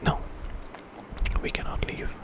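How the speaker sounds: usual speaking voice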